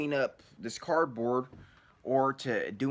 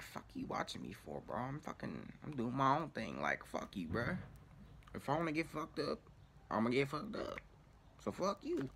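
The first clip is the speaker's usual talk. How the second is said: muttered retort; 11 dB quieter than usual. Retold or imitated. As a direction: mutter it under your breath, annoyed. Irritated retort to watching man.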